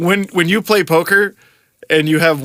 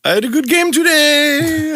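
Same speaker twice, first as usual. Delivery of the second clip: higher, slow bad South African accent; terrible South African accent; 10 semitones higher than usual. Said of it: "That was a terrible South African accent".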